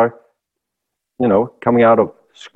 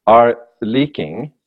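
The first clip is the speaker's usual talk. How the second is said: usual speaking voice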